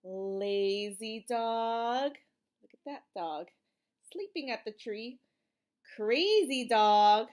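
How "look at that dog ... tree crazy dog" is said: usual speaking voice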